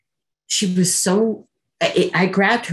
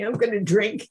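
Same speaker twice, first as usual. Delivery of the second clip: panicked; 6 semitones higher than usual. Retold or imitated. Panicked girl repeats herself.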